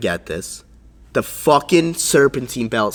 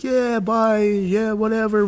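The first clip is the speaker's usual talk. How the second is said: high, careless brush-off; mock-casual bye, high-pitched; dismissive guy; 9 semitones higher, slower than usual. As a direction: wave him off like you don't care. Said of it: "He's like".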